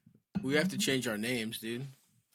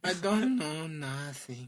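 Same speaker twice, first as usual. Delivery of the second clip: noir suspect; 3 semitones higher, much slower than usual. Pretend. Answering the noir bit.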